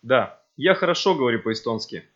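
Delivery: ordinary talk, nothing marked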